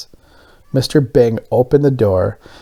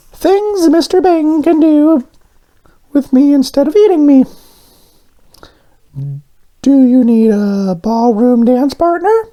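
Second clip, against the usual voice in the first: much higher, eager little donut voice; Arnie the donut; 4 dB louder than usual. Acted. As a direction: play the hopeful little donut pitching ideas, up high.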